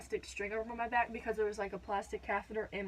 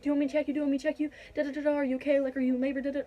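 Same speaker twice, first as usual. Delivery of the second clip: higher, louder rushed mom questions; flustered mom; 4 semitones higher, 4 dB louder, much faster than usual. Retold or imitated. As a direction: fire off questions like a startled mom. Mom jumps up, panicked.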